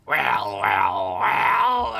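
lightly gravelled villain voice; a hint of a gravelly rumble. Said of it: "a gravelly voice rumbled".

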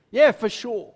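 ordinary delivery

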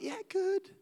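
high, bright put-on fine; fake cheery church voice. Churchgoer faking cheer at the door, high and chirpy. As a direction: pretend all is well, chirpy and high.

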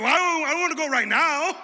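laughing protest. Retold or imitated. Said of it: "I was like".

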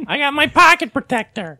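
high mock nerd voice; nerdy Eugene. Eugene showing off his protection, high nerd voice. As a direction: pipe up as a caricature nerd. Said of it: "like a nerd with a fucking pocket protector".

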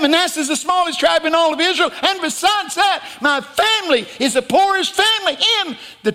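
high, loud comic wail of excuses; whining protest. Acted. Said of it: "He started whining".

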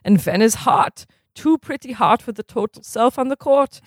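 lightly put-on German accent, formal and measured; a stern German coach scolding in a mild German accent. Stitt lecturing sluggish players at dawn drills, mild German accent. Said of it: "do the accent again".

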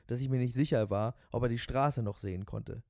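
ordinary delivery

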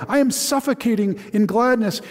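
high cry of gladness; the father cries out his joy again; overjoyed cry. Acted. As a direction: gush with happy tears. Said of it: "he cried".

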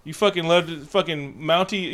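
ordinary delivery

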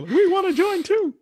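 very high, slow squeaky pup voice; dog voice. Retold or imitated. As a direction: speak for the barking dogs in a silly high voice. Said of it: "dogs barking in the background".

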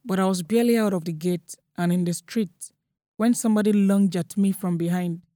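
usual speaking voice

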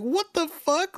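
sharp, high-pitched exclamation of shock; stunned disbelief. Retold or imitated. Retelling her reaction to the moderators' snarky sign-off, stunned disbelief. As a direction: blurt it out like you can't believe what you just heard.

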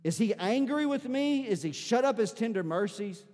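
loud, pleading questions to God; anguished lament. Acted. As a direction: cry out to God in distress. Voices psalmist's anguished questions.

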